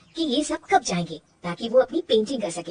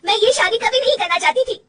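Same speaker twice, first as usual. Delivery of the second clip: much higher, crying voice; tearful confession; 11 semitones higher, 4 dB louder than usual. Acted. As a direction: break down admitting she never wanted marriage. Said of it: "Rajni रोने लगती है". (रोते हुए कबूल करना)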